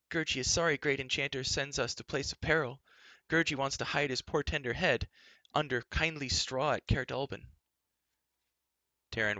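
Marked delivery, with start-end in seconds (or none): 0.1-7.5 higher, plaintive little beast voice; timid creature. A narrator's reading. Gurgi pleading to hide at home, plaintive higher creature voice. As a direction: whine like a frightened furry creature.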